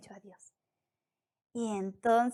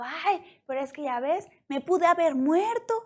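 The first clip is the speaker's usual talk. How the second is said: higher, indignant mock grumble; hammed-up complaining, building louder at the end; mock complaint; 5 semitones higher, 4 dB louder than usual. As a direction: act out an aggrieved protest. Imagined complaint Daniel didn't make.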